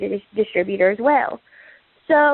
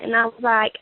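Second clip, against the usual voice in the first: ordinary talk, nothing marked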